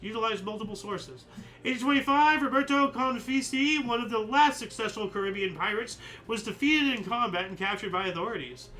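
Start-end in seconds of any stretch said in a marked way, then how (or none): 1.6-8.7 upbeat announcer read of dates; almanac read. Acted. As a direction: announce the entry, higher and louder. Reads almanac entry.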